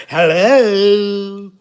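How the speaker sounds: high, put-on cartoonish Harley Quinn hello. Mocking the baby mama answering the phone, Harley Quinn voice. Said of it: "Bitch talking like Harley Quinn".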